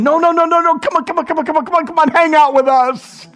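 high, excited begging to stay; pleading urgently. Retold or imitated. Disciples urgently beg Jesus to stay, high and eager.

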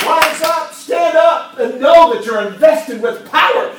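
loud, high rallying cry; voicing Jesus, shouting. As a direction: call them up to rise with force. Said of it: "what Jesus is saying".

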